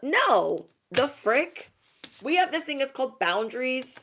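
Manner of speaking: high, incredulous mock outrage; indignant rebuff. You setting boundaries with former doubters, indignant. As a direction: cut them off, pitched right up.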